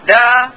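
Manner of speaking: دا said with a clear pitch glide that sets it apart from the level take; Mandarin tone demo. Shown as a demonstration. Teacher showing students a second Mandarin tone, gliding pitch. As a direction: bend the pitch through دا to change its meaning. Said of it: "إذا قلتها بالشكل هذا".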